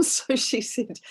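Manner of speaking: usual speaking voice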